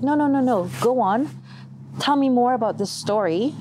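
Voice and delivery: high eager pleading to go on; urging them not to stop. Retold or imitated. To the brothers closing the Bible, high eager pleading.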